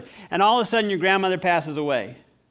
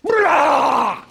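loud, high mock roar; sudden monster roar; 8 semitones higher, 9 dB louder than usual. Devil jumps out to scare his visitor, loud mock roar. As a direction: burst out with a startling roar. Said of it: "all of a sudden he goes".

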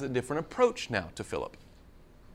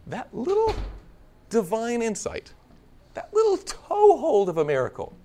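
awed emphasis; 9 semitones higher, 3 dB louder than usual. Real, not acted. Preacher marvels at miracle.

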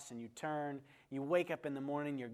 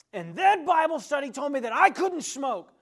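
much higher and louder, indignant; indignant complaint; 12 semitones higher, 15 dB louder than usual. Retold or imitated. Joe protesting to the pastor, loud and high.

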